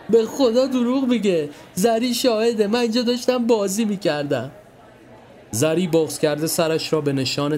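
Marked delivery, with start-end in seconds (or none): 0.1-4.5 high, pleading mock sobs; fake crying harder. Milad pleading with his mother, hammed-up crying. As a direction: beg Mom to believe him.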